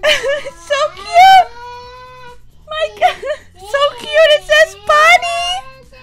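high, slow, laughing squeal; squealing over cuteness. Real, not acted. Vlogger gushes over the tiny Forky figure, high laughing squeal. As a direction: gush like your heart is melting.

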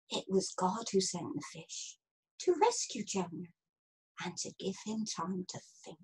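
soft, low, gentle narration; hushed reveal. A narrator's reading. Narrator explaining God's rescue of Jonah, hushed. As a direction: drop right down and tell it softly.

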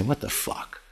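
unvoiced, hushed "What the fuck?"; whispered curse. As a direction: mouth the curse under your breath. Boss gets to say it.

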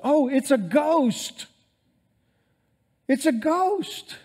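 high, slow, frightened wail; terrified disciples. Retold or imitated. Pastor voicing the disciples seeing a ghost, frightened wail. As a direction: cry out like you've seen a ghost in the storm. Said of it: "The disciples went".

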